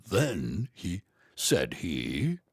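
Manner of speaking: put-on deep old British storyteller; old British guy. Shown as a demonstration. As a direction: rumble it like an old English gent. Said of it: "doing the old British guy".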